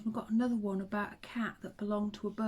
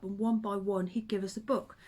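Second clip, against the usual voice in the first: usual speaking voice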